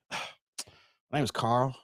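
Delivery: slow, tired, put-upon reply; weary sigh. Acted. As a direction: sigh before you answer, dragging it out. Weary resident answers the door.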